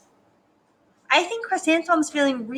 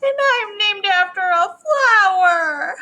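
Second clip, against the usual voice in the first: mock tearful whine, building to the end; crying child; 7 semitones higher, 4 dB louder than usual. A narrator's reading. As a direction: bawl it out like an upset little girl. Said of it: "I think she's crying".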